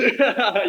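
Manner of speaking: sudden high, loud laugh; a real laugh breaking out; burst of laughter. Real, not acted. Laughs at own story.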